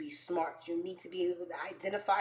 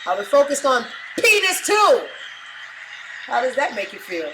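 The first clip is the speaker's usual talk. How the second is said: loud, high defiant declaration; brazen comeback; 4 semitones higher, 10 dB louder than usual. Acted. Answering Skip's remark on school, brazen. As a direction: shout it proudly, punching the end.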